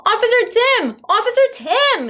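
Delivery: very high, loud yell. A narrator's reading. Tiffany Ann yelling for Officer Tim. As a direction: yell to get the officer's attention. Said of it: "yelled Tiffany Ann".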